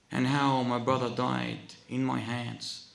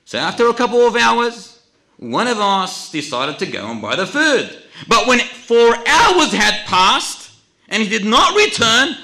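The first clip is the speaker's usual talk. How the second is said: loud, raised sermon voice; forceful preaching; 11 semitones higher, 14 dB louder than usual. Real, not acted.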